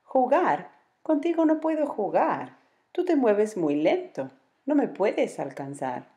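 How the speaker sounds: high, huffy jaguar refusing, slowing at the end; sulky young jaguar brushing off the bear; grumpy jaguar. Acted.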